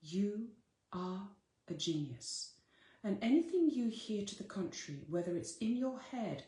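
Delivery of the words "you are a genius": "You", "are", "a genius" spaced out with pauses between each word; each word set apart, slowly and deliberately. Shown as a demonstration. Teacher to her listeners, slow and spaced out word by word. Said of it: "I'll say it again".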